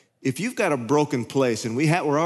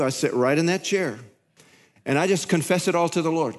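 ordinary delivery

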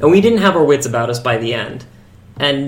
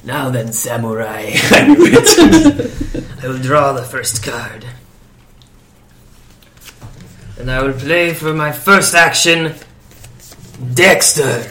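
Aku voice; much slower than usual. Acted. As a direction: draw it out like a cartoon demon lord. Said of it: "Is it Aku?".

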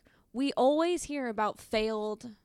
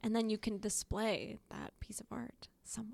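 conspiratorial whisper; 8 dB quieter than usual. Acted. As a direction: whisper it like a secret plan. Conspiratorial aside about stolen art.

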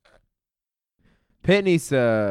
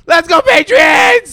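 full-throated sports cheer; 13 dB louder, slower than usual. Real, not acted. Fan shouting for the Patriots, loud cheer. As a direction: yell it out for the team.

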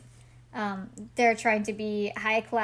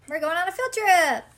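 higher, louder sing-out of excitement; excited cheer; 7 semitones higher, 6 dB louder than usual. Pretend. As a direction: call it out like an eager kid. Playful exclamation of the title.

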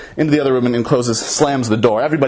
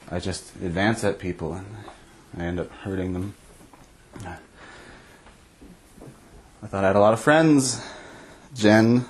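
soft, low, mock-weepy plea; hint of sobbing through the apology; tearful confession; 7 semitones lower, 6 dB quieter than usual. Drunk [name] crying to Jen about being alone, soft and weepy. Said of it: "I start to bawl my eyes out".

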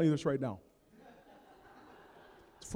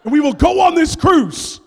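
much louder, higher declaration; insistent wife; 7 semitones higher, 15 dB louder than usual. Retold or imitated. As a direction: overrule him loudly. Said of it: "She's like".

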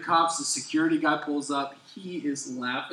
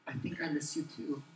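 soft reply; 11 dB quieter than usual. Retold or imitated. Tender reply.

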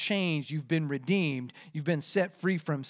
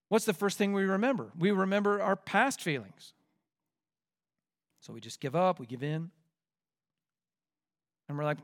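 ordinary talk, nothing marked

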